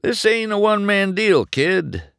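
put-on high, loud, firm old racer; stern veteran warning; the king, stern. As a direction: set the kid straight. The king lecturing.

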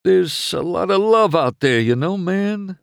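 lightly voiced teary, mellow hippie; a little choked up, laid-back; mild emotional hippie van. A narrator's reading. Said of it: "Fillmore teared up a little".

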